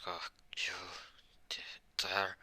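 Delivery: whispered, halting outrage; acted menacing whisper; seething whisper. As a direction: whisper it in broken pieces, as if too outraged to speak. Acted outrage at being attacked.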